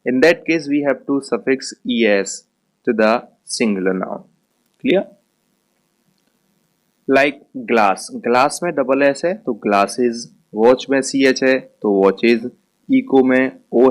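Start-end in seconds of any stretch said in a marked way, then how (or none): none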